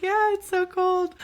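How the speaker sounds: very high, loud, drawn-out agreement; playing along. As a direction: humour him in a high, slow voice. Plays along with guard's joke.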